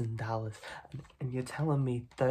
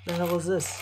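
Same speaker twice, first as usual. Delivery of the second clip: louder, higher disbelief; indignant; 5 semitones higher, 6 dB louder than usual. Acted. Mob boss shown a bag of coins, indignant. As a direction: demand an answer.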